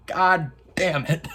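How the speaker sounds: mock grumble at fake Mandos; annoyed mock voice. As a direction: sneer it like a disgusted rival. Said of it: "and he's like".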